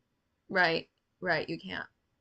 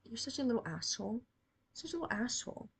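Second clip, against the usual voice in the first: much softer, half under the breath; muttered insult; 8 dB quieter than usual. Real, not acted. Vlogger mutters at a TV character, softly. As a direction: mutter it like a private jab.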